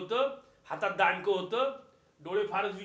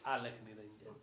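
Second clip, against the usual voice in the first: murmured reverently, much lower and softer; soft, low invocation; 9 semitones lower, 10 dB quieter, slower than usual. Shown as a demonstration. Preacher reflects that the sound is everywhere, soft low murmur. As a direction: say it inwardly, as a sound present everywhere. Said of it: "आवाज आहे प्रत्येक ठिकाणी".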